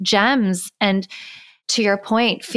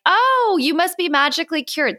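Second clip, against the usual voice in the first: high, fast, sing-song mockery of clueless people; mock sarcastic chirp; 7 semitones higher than usual. New mom mocking what people tell her, high sarcastic chirp. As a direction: chirp it like a clueless well-wisher. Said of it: "and, like".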